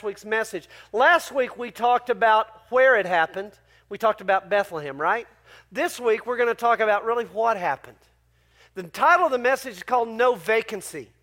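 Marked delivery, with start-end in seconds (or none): none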